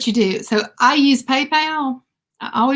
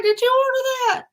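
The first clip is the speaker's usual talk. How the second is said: urgent question; 14 semitones higher than usual. Retold or imitated. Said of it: "I was like".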